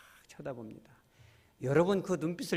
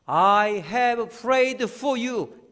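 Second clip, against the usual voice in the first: very slow, higher and louder; slow tender quote; 8 semitones higher, 7 dB louder, much slower than usual. Acted. Jesus telling Simon he has prayed for him, slow and tender. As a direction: stretch each word lovingly.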